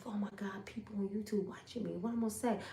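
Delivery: hushed, nervous under the breath; nervous whisper. Retold or imitated. Woman going live for the first time, nervous whisper. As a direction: whisper it like someone might hear. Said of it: "And I'm like".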